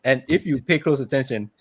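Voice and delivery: ordinary talk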